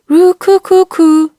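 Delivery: high, loud bird coo; pigeon cooing. A narrator's reading. A pigeon cooing outside, high and loud. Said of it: "came again".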